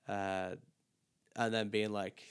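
normal talking voice

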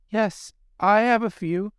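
put-on high, hopeful girlish reply; eager young mermaid. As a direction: answer quick and high. Mermaid answers witch.

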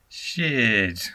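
"Shit" as a falling, amazed exclamation about tasty ramen; impressed shit. Shown as a demonstration. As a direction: let "Shit" fall, mildly amazed. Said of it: "You would say something like".

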